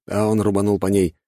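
ordinary delivery, nothing marked

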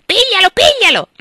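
very high, loud parrot shriek; screaming parrot. Acted. Parrot terrified of the spider on the aunt's chair, shrieking.

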